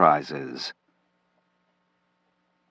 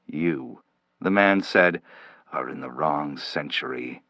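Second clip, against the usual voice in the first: much softer, lower ominous pronouncement; 6 semitones lower, 7 dB quieter than usual. Acted. Stranger warning Slade he is in the wrong century, ominous hush. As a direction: drop it to a grave hush. Stern stranger delivers grim verdict.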